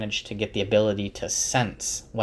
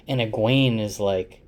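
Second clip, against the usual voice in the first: normal talking voice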